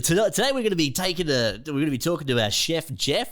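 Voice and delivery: fast, chirpy breakfast TV host patter; morning show host. Retold or imitated. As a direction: rattle it off like a cheerful morning host. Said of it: "We're like".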